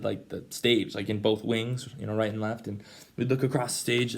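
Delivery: ordinary delivery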